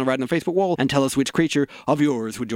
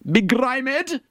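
grand declamation; 10 semitones higher than usual. Shown as a demonstration.

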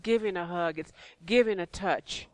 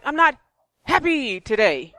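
mock protest; 5 semitones higher, 7 dB louder than usual. Answering the always-happy pastor, loud comic protest. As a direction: push back against someone's constant cheer. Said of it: "And I'm like".

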